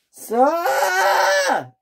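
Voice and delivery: high desperate cry of sir; desperate shout. Acted. James shouting after the departing controller. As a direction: call him back, high and loud.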